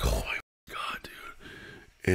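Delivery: breathless shocked whisper, no voice at all. Retold or imitated. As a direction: whisper it like you can't believe it. Shocked after police extortion.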